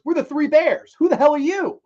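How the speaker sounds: high, loud comeback in mock indignation; instant retort. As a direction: fire the challenge straight back, acting it out. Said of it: "John Candy, without missing a beat, goes".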